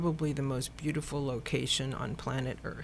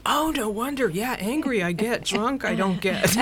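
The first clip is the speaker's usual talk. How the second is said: quoting her reply through laughter, louder; laughing while quoting; 6 semitones higher, 7 dB louder than usual. Retold or imitated. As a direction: still giggling at the mix-up.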